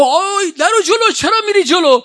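very high, loud yell; shouting. Retold or imitated. Caravan manager shouting at pilgrims cutting ahead, very loud.

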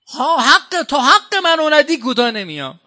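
stubborn claimant. Retold or imitated.

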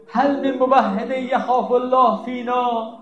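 high, slow pleading call; Imam's cry. Retold or imitated. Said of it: "فریاد زد". (ندای بلند و آهسته)

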